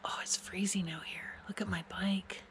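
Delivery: hushed whisper in the cold. Real, not acted. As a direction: whisper it, shivering outdoors. Cold night outside in snow.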